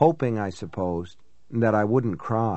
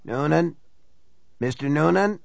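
lightly voiced elderly man, rasping; a hint of an old man's rasp; raspy old man voice; 4 semitones higher than usual. A narrator's reading. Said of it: "a man's raspy voice, an elderly voice".

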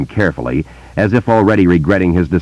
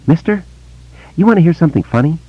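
put-on higher, eager boy's voice; young boy; 12 semitones higher, faster than usual. A narrator's reading. Boy telling Bill about voices, in a higher boy's voice. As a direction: voice a wary small boy telling a secret.

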